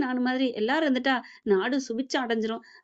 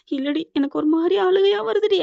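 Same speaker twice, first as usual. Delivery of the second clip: higher, louder imitation of crying; mock-tearful voice, raised and pushed; retold tearful wail; 6 semitones higher, 5 dB louder than usual. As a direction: act out a mother starting to cry, pitch lifted. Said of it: "அப்படின்னு அழுகுறாங்க".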